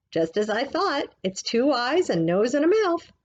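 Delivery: put-on triumphant old woman rising to a cackle; old woman's voice, growing. Missus Partridge voiced.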